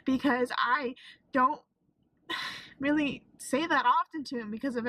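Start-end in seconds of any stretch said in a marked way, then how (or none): none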